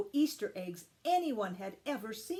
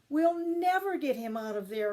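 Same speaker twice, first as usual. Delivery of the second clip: higher, louder despairing moan; 5 semitones higher, 5 dB louder than usual. A narrator's reading. Mother seeing Ralph caged, hopeless moan. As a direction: give up hope aloud. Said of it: "moaned their mother".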